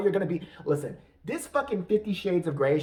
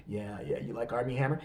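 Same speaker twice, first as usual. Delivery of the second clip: low, softened bedroom murmur; hammed-up pillow-talk voice; Armie Hammer; 7 semitones lower, 5 dB quieter than usual. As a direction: play a mock seducer, low and hushed.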